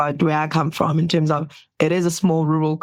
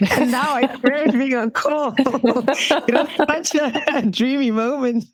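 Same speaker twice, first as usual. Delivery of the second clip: real laughter running through the words, a little slower; laughing while talking; 8 semitones higher, slower than usual. Real, not acted. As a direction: giggle through it, amused at herself. Laughing at her old dream.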